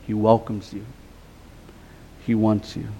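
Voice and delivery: dropped low and quiet, tender reassurance; soft and tender. Real, not acted. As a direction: ease right down, gentle and sincere. Tender aside in sermon.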